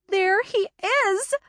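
high, slow excited shout. A narrator's reading. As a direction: call it out high and thrilled. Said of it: "Michelle shouted".

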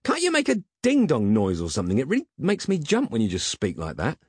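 high, agitated scolding; complain, still jumpy from a fright; startled complaint. A narrator's reading. Startled Peg scolds his butler.

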